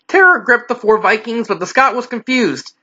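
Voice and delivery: ordinary delivery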